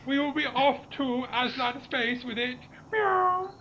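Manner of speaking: high, slow cat-alien gloating; booming arrogant alien overlord. Pretend. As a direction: sneer through a laugh, cat alien. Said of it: "record a thing that says".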